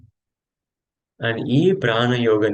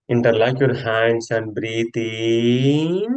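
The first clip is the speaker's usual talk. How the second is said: yoga instruction; much slower than usual. Acted. Yoga teacher leading friends through a stretch, drawn-out cues. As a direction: stretch the words out with the movement.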